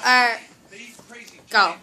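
ordinary delivery

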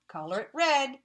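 normal talking voice

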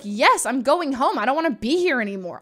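loud, dramatic retold tantrum; obnoxious. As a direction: stretch the yes, then rush the complaint. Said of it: "I was so obnoxious".

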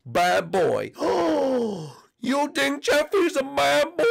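high, slow mock-offended voice; high gasping cartoon voice; squeaky character voice. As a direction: play the shocked character high. Role-played Jeffy exchange.

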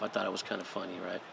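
ordinary talk

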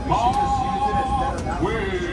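long drawn-out depth call; leadsman's call. Acted. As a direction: sing out the sounding across water. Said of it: "the leadsman calling out".

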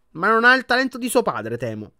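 a disappointed grandmother judging; stern grandmother. A narrator's reading. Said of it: "sguardo severo". (nonna severa e delusa)

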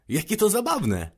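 wysoki, zachwycony okrzyk; rozbawione zdziwienie. A narrator's reading. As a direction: wykrzyknij z dziecięcym zachwytem. Mały książę zdumiony minutą. (radosne zdziwienie dziecka)

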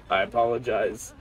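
normal talking voice

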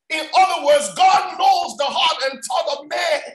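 high, ringing scripture preaching. Real, not acted. Preacher quoting Isaiah to the congregation, fervent preaching. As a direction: proclaim the promise, building in force.

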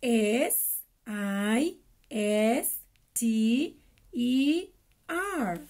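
letters one by one, slowly; slow spelling. Shown as a demonstration. Teacher spells for kids, slow and clear. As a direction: spell S I S T E R out for children. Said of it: "practiquemos un poco cómo deletrear".

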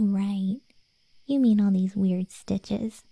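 ordinary talk, nothing marked

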